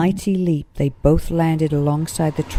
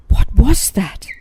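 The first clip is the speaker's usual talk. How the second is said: startled; 6 semitones higher, 8 dB louder, slower than usual. A narrator's reading. Startled after the monster passes.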